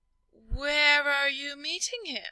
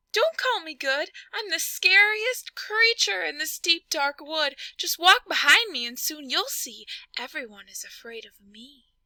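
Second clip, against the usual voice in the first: boastful mouse; 6 semitones higher, 4 dB louder than usual. Acted.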